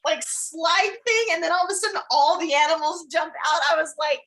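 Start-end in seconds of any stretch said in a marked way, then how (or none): none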